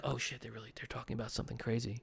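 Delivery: low, soft near-whisper, imitated; hushed. Child overhearing grown-ups whisper about something important, hushed. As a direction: drop the voice as if secretly overhearing. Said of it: "they could be whisper talking".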